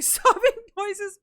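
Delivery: high, loud, drawn-out words with a laugh; hammed-up denial breaking into laughter; mock sobbing. Answering a viewer asking about crying, mock sobbing.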